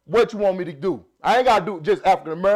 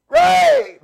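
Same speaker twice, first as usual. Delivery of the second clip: high, loud shout of a name; calling out; 10 dB louder than usual. Retold or imitated. Recalling who the movie character called, a shouted cry. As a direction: holler it like a film character calling someone. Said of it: "what was his name he was calling".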